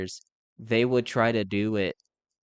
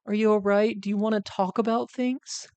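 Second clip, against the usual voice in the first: gentle caring parent; 8 semitones higher than usual. Retold or imitated. Ideal parent checking on a struggling child, gentle and high. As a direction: ask it the way a caring parent should, pitched up. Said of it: "the first question would be like".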